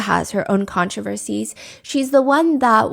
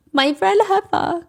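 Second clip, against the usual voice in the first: high, slow whiny mimicry of Ashley; mocking Ashley; 9 semitones higher, slower than usual. Mocking a streamer whining about a rival, high and slow. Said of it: "she says".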